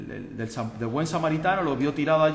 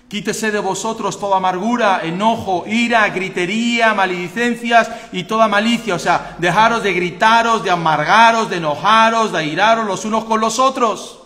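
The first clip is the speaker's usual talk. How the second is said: loud, high pulpit cry; fiery preaching; 8 semitones higher, 10 dB louder than usual. Acted. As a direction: thunder the scripture at the church. Preaching at full force.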